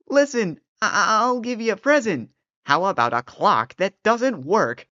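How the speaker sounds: high, desperate pleading. Acted. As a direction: beg for your life. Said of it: "began to plead".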